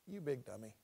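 low, soft aside of mock scolding; imagined rebuke. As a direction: drop it low and quiet, dryly reproachful. God's imagined scolding of Joshua.

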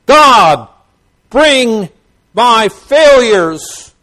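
loud, high preacher's cry; impassioned pulpit shout, words drawn out; crying out to God. Acted. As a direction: call it out slowly at full force. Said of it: "he cries unto God".

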